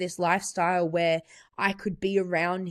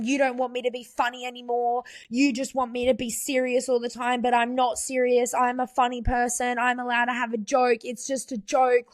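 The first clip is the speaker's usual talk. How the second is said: higher, defensive rant, firing back; full attack mode; 6 semitones higher than usual. Retold or imitated. Boyfriend lashing out at her over a joke, higher defensive rant. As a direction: mimic him going on the attack, pitched up. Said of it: "full attack mode".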